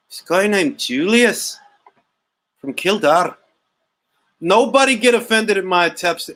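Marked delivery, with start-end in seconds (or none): 0.1-3.4 put-on drawn-out, lilting Irish accent; mock Irish accent. Irish agent naming the suspect, mock Irish accent. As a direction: imitate an Irish agent, swooping on the words. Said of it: "attempts at doing accents".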